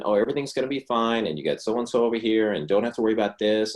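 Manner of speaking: bright, quick, overly reassuring parent voice; mock soothing parent. Parent falsely soothing an anxious child, overly cheery. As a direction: gush false comfort at a child. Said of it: "do you think I'm calming them down".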